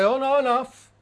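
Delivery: lightly acted, shutting the Spirit out; refusing. Preacher voicing a reluctant believer, mock refusal. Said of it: "Will I put the portcullis down and say".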